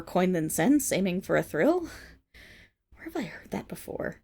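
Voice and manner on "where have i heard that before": hushed wry aside; sly whispered jab; whispered sarcasm. Acted. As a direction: murmur it to herself.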